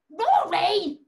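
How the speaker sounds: mock scolding shout. Puppet stole the ball again.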